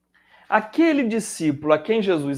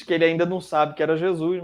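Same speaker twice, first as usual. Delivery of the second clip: ordinary delivery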